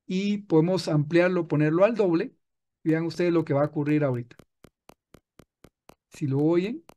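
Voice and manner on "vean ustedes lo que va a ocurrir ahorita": normal talking voice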